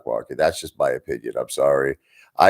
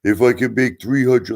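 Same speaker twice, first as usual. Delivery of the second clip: a half-hearted cartoon detective bragging about small wins; Detective Pikachu impression; 2 semitones higher than usual. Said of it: "like that Detective Pikachu".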